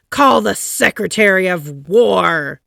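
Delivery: loud commanding call; shouted order. A narrator's reading. President shouting for the Secretary of State. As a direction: bark the order across the house. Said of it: "shouted President Taft".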